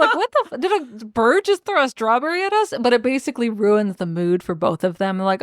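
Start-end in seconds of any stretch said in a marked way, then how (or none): 0.2-2.7 high, half-laughing indignation; mock disbelief. Startled couple hit by a thrown strawberry, mock disbelief. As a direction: squeal it in amused mock outrage.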